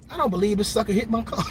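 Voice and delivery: ordinary talk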